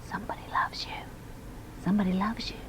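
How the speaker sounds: breathy whispered secret; soft unvoiced whisper of a secret. A narrator's reading. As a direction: breathe it like the dust cloth whispering. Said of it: "seemed to whisper".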